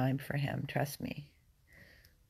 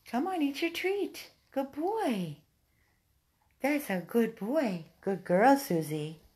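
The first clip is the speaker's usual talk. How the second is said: high sing-song praise; doggy praise; 7 semitones higher, 3 dB louder than usual. Real, not acted. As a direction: cheer them for eating. Praising dogs for eating.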